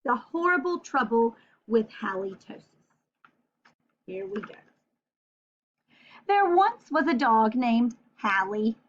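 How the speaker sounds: lively picture-book read-aloud; reading to children. Acted. Teacher reading a picture book aloud to her class. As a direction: read the story brightly to young kids.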